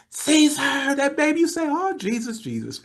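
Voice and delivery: very high imitated holler, dying away at the end; screaming. Wife calling her husband with the news, mock screaming. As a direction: holler for the husband, then subside. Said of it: "Oh, she was screaming".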